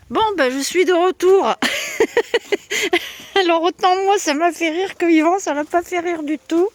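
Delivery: giggly, high, amused voice; laughing. Real, not acted. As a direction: still giggling over it as she speaks. Laughing about the bird attack.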